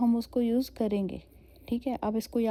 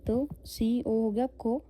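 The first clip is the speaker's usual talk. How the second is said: ordinary delivery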